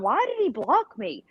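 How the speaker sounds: high-pitched, baffled complaint; mock-bewildered protest; retold indignant question. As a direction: reenact asking in disbelief, pitch jumping up. Retells her upset at being blocked.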